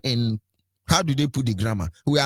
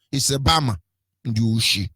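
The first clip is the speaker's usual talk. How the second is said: ordinary talk, nothing marked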